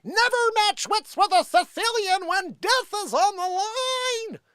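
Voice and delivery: high slow Vizzini voice; Vizzini impression. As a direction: play the smug Sicilian. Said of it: "remember Princess Bride".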